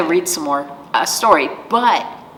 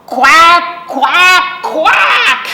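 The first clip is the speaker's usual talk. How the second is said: loud, much higher quacks ending in a laugh; big quacks; 12 semitones higher, 8 dB louder, much slower than usual. Shown as a demonstration. Librarian getting everybody to quack along, loud high quacking. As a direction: shout the quacks for everyone to join. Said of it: "let me hear everybody".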